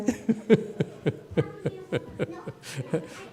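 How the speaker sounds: high, drawn-out airy chuckle; long laugh. Real, not acted. Amused at his own Chinese phrase.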